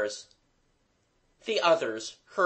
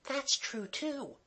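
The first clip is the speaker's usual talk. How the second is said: put-on high, soft girlish stage whisper; 12 semitones higher, 7 dB quieter, slower than usual. A narrator's reading. Kathleen confiding to the others, whispering. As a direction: murmur it high and small. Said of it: "Kathleen whispered".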